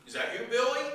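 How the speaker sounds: lightly voiced high old grandpa; imitated grandparent. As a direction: ask hopefully as a grandfather. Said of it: "the call goes like this".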